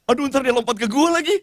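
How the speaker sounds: mock fright of a nervous prayer minister; scared. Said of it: "Tiba-tiba dia jadi takut".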